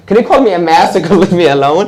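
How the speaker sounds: high, loud, fast laughing plea; laughing mock plea. Speaker retelling a stranger's stare, laughing. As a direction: giggle it out, pleading to be left alone. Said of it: "like, even when I looked at him".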